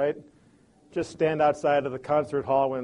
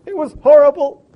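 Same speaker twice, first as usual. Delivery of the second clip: high, loud, breaking complaint; upset wife; 10 semitones higher, 11 dB louder than usual. Acted. Wife at the sink unloading her bad day, upset and high. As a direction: pour it out near tears, pitch up. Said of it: "she breaks and she goes".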